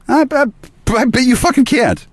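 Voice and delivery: high-pitched mocking squeal; mocking taunt. Speaker daring the listener to control their brain, mocking taunt. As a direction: goad them in a silly high voice.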